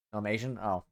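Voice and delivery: ordinary talk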